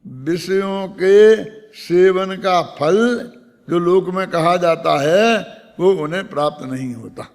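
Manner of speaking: slow, measured verse recitation; scripture recitation. Acted. Preacher reciting and glossing a verse for listeners, slow and measured. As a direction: draw out each phrase of the verse deliberately.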